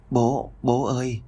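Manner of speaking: put-on slow, small sick child's voice; sick little boy calling his father; weak child calling. A narrator's reading. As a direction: call out faintly like a tired little boy.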